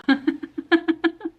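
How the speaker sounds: high laugh breaking out; burst of laughter. Real, not acted. Reading a silly review, bursting into high laughter.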